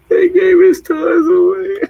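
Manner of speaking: high squeaky weepy wail, laughing; mock crying. Woman imitating her own movie theater crying, high laughing mock sob. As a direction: drag it out slowly like a tearful high-pitched whine. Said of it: "what I kept saying when I was crying".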